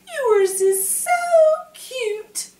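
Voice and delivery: high sweet flirting; Twiggy touching his nose, high and sweet; flirty Twiggy. A narrator's reading. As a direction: coo it at him.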